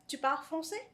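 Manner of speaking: "Tu parles français" as a high, rising question; question intonation. Shown as a demonstration. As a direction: ask "Tu parles français" by pitch alone, lifting "français". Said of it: "So that's already a question".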